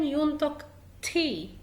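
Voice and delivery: usual speaking voice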